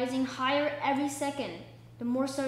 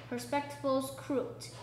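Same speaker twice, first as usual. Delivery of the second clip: ordinary delivery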